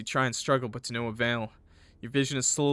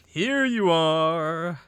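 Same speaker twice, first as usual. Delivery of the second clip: long drawn-out, higher taunting call; creepy slow villain discovery; 4 semitones higher, 6 dB louder, much slower than usual. Acted. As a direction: stretch it out like a hunter finding prey. Villain finds hiding victim.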